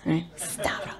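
hushed whisper of a name; savoring the name in a whisper. Pretend. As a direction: breathe the name. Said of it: "I know".

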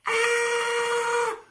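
high loud mock yell; mock scream. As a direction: shriek like the fleeing kids. Imitating the creatures' cry.

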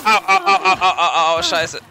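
loud, high ow ow ow; pained yelps. Real, not acted. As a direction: yelp in pain, laughing. Getting hit in the game.

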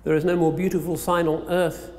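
normal talking voice

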